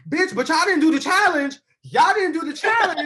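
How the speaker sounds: high, loud mock outrage; acted-out confrontation. Retold backstage quarrel, acted out.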